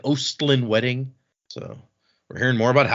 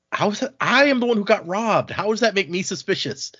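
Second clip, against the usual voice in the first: higher-pitched mock indignation; indignant protest; 7 semitones higher than usual. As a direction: protest like the wrongly accused. Acted protest at being accused.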